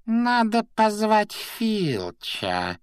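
very high, drawn-out imp voice; high-pitched sly pondering, stretched out; musing poltergeist. A narrator's reading. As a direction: muse aloud slowly in a squeaky voice. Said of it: "задумчиво проговорил Пивз".

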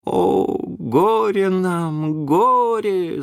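high, long-drawn lament; theatrical high keening; wailing cry. A narrator's reading. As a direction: wail it out in woe. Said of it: "закричал кто-то".

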